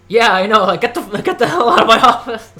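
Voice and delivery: high loud laughing yell; hammed-up loud outburst; mock outraged shout. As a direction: throw someone out in mock outrage. Mock Tom Hanks reaction.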